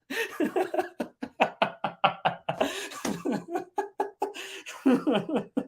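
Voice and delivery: long high helpless laughter; laughing till breathless; long laughing fit. Real, not acted. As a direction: crack up for real, high and out of control. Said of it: "I'm laughing so much".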